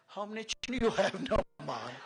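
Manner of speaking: loud, high West Indian question; West Indian accent. Retold or imitated. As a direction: imitate a cheeky West Indian youngster. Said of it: "this is how they speak".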